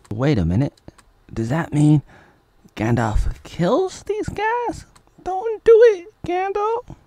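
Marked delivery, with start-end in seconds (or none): 2.8-7.0 falsetto movie character; hammed-up high fake dub; high silly dub voice. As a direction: squeak the lines like a cartoon. Falsetto dubbing.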